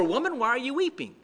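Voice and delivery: quick, brisk question; angels asking. Acted. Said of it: "two angels who ask her".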